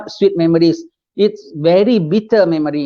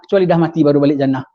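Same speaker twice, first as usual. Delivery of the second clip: normal talking voice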